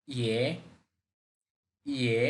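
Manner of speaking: ইয়ে low and broken; ইয়ে in a low broken third tone; Mandarin third tone. Shown as a demonstration. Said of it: "third tone ভেঙে বলতে হবে".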